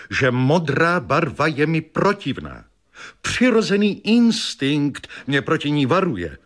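high-pitched, emphatic self-justification; insistent wife. A narrator's reading. Wife reminding husband she always hated blue, insistent. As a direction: insist with pitch lifted throughout.

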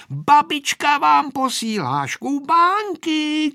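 high, loud, drawn-out call; child shouting. A narrator's reading. Aneška delivering grandma's food to grandpa, shouting. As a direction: call out slowly in a high girl's voice. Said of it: "křičela Aneška".

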